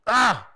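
short burst of frustration; exasperated cry. Acted. Host venting over a fawning guest, exasperated outburst.

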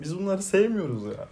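normal talking voice

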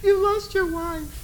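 high, wavering voice that fades to a sob; mimicking a tearful, sobbing wife; mock sobbing wife. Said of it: "She says".

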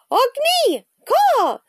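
high, loud cawing; raven croak. A narrator's reading. Jack calling Annie back from the ledge, high croak. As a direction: squawk out like an alarmed raven. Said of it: "Jack croaked".

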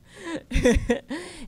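bright high burst of laughter. Real, not acted. Laughing at her own impression of him, high laugh.

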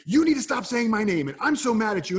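an exasperated mother losing her temper; scolding mom. Retold or imitated. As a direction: tell the child off hard. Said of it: "if she was instead saying".

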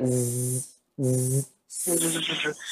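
soft drawn-out zzz buzz like a bee; buzzing hiss. Shown as a demonstration. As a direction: hum the za like a bee. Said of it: "jadi desisnya itu dengar dia".

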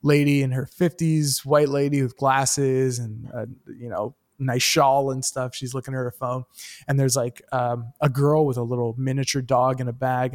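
ordinary talk

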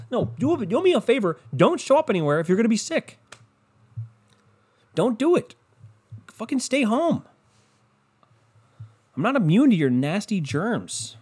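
angry rant. Real, not acted. Ranting at sick people.

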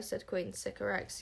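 ordinary delivery, nothing marked

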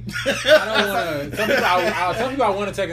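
high, loud words through laughter; laughing. Real, not acted. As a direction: laugh while you say it. Laughing over texting talk.

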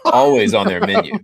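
Always lifted high and loud; sarcastic stress. Real, not acted. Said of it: "sarcastic emphasis".

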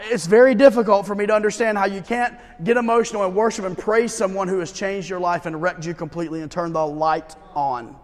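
normal talking voice

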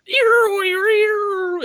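high, loud wailing imitation of show music; weird eerie sound effect. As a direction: mimic a scary rattling sting. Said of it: "they do this weird sound".

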